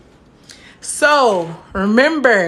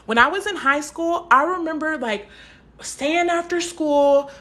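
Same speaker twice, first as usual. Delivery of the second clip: ordinary delivery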